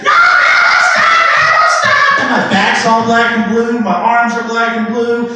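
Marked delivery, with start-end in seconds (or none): 0.0-2.2 very high, loud mock shriek; hammed-up wailing child. Boy hit with broom by grandma, shrieking pleas. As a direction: squeal for mercy like a little kid.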